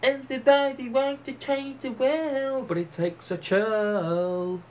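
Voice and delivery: lightly sneering, slowing on the last words; mocking quote. Ranting vlogger sneers at a serf's slogan, mock-solemn. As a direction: send up the pompous line, drawing out chill. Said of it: "He's saying it was a revolution".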